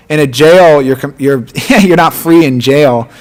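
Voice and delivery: ordinary delivery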